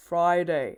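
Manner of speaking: ফ্রাইডে said as fry plus day; ফ্রাইডে modelled clearly, fry-day; Friday demo. Shown as a demonstration. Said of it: "ফ্রাই plus ডে".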